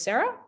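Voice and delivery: "Sarah?" rising high as a question; "Sarah" as a puzzled high question. Shown as a demonstration. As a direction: ask "Sarah?" with a high rise. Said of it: "say my name in three different ways".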